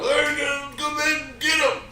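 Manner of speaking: rowdy mock battle cry; drunk rallying shout. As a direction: hype your buddies into the fight. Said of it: "and we're like".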